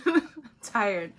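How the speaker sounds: laughing it off as a weary joke; tired words broken by a chuckle. Shown as a demonstration.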